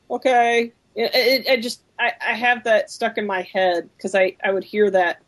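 ordinary delivery

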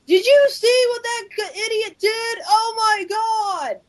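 high, outraged yell from another room; yelling across the room. Retold or imitated. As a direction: yell across the house at a dumb teammate. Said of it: "they'd be yelling across the house".

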